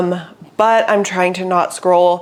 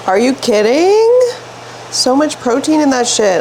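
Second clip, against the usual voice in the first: real thrilled gush over lunch; excited gush; 6 semitones higher, 3 dB louder than usual. Real, not acted. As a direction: rave about the food. Showing off lunch.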